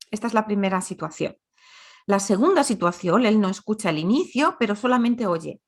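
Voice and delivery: ordinary talk, nothing marked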